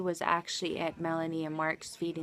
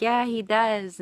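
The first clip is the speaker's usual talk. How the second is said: louder, higher emphatic agreement; raised, lively quoted reply; retold excitement; 4 semitones higher, 6 dB louder, slower than usual. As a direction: agree with gusto, re-enacting the moment.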